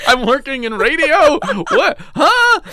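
high, loud, laughing disbelief; bewildered laughing. Acted. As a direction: laugh through shrill disbelief.

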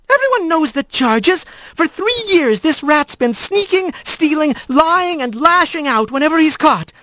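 high-pitched, scolding magistrate squirrel; indignant magistrate. Acted. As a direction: rattle off the accusations in a high, cross voice. Voices an angry magistrate in story.

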